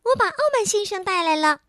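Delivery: elf voice. Acted. Elf answers guard.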